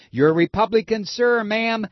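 usual speaking voice

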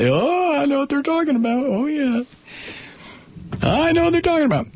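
high, fast mock-sheepish voice; mock embarrassed Southerner. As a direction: mimic the guilty neighbours, quick and high.

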